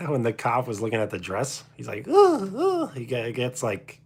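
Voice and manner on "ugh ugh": very high, loud squeaky disgusted grunts; Pee Wee grunts. Retold or imitated. Cop looks at the dress, high squeaky Pee Wee grunts. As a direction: squeak out two grossed-out grunts. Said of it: "Pee Wee Herman was just one of a kind".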